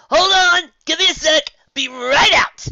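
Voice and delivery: shouting from the next room; calling out. Acted. Spike calling from kitchen.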